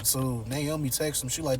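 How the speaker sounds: ordinary talk, nothing marked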